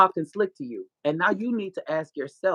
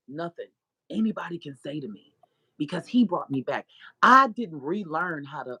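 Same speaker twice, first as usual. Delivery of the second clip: ordinary delivery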